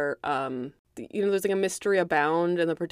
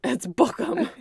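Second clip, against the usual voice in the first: laughing; 7 semitones higher than usual. Real, not acted. Laughing at the cop line.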